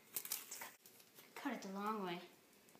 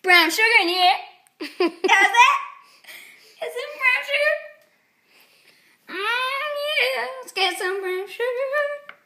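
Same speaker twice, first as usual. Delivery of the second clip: higher, louder, pumped-up host; more enthusiastic; 10 semitones higher, 9 dB louder than usual. Pretend. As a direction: sell it with big energy. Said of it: "Be more enthusiastic".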